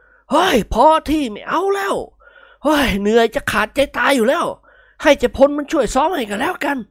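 puffing, fed-up complaint; out of breath from charging; exhausted old man. A narrator's reading.

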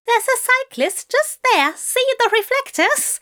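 very high, urgent warning; urgent alarm. Acted. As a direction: point out the danger urgently. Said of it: "pointed urgently".

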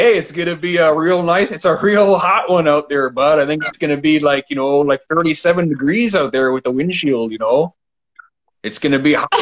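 hammed-up Canadian small talk about weather; Canadian accent. Shown as a demonstration. Host demonstrates for guest, exaggerated Canadian accent. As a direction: put on a folksy Canadian accent, bud. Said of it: "if you want to make it Canadian".

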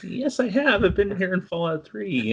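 high-pitched playful protest; hammed-up indignant comeback, pitched high; mock insistence. Retelling his comeback to his wife.

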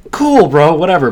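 mock dismissive. His teenage self brushing off the band director, mock sarcastic. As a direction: toss it off like a bored teenager. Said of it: "I'm like".

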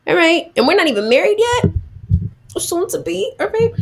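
high-pitched indignant disbelief; acted incredulous outburst; stunned Samson. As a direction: imitate an affronted groom, voice shooting way up. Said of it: "and Samson's stunned".

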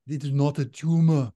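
a firmer go at the Austrian action-hero voice; better Arnold impression. Redoing Arnold for cohost, a bolder impression. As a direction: commit to the Arnold Schwarzenegger voice this time. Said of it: "I need to do a better one".

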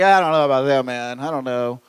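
mild mock of a shrugging pew-sitter; reluctant churchgoer.

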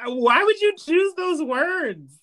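high, incredulous mock protest; indignant retort. Fan answering Rue's sissy remark with high incredulity. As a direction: squeak out the disbelief.

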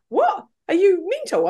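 high, incredulous comeback. Retold or imitated. Shocked mourners answering her odd advice, incredulous. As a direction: react as if the advice is crazy.

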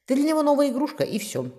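high start, dropping firmly to the end; decisive. A narrator's reading. Brother warning sister about her suitor, decisive. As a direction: close the argument with finality. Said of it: "решительно заключил юноша".

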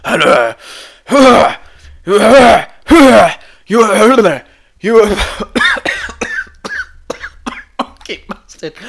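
loud growled boss impression breaking into coughs; strained, halting impression, collapsing into a cough; Vince McMahon. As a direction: push a failing impression until the voice gives out. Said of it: "I cannot do a Vince McMahon impression".

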